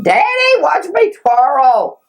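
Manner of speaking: put-on high, eager child calling out; a small boy begging his father to watch; little boy voice. Boy begs Daddy to watch his twirl, high and eager.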